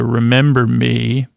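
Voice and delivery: usual speaking voice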